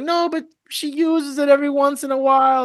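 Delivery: mildly imitating his wife defending the toy; lightly voiced wife. Wife defends keeping toy house.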